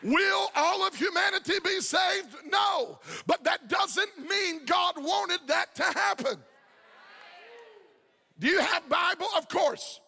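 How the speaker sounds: full-force preaching. Acted. Pastor urging the congregation that God wants everyone saved, full-force preaching. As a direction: preach it out to the whole church.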